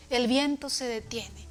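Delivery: normal talking voice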